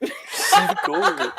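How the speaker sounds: high, slow mocking line. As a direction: squeal it like a teen movie. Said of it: "That's Gemma if she knew".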